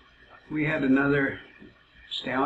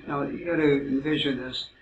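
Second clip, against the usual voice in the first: normal talking voice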